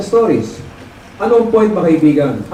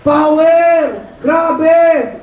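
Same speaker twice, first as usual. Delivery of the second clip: crowd shouting; 10 semitones higher, much slower than usual. Retold or imitated. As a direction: belt it out like a hyped seminar crowd. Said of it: "mga tao ay nagsisigawan".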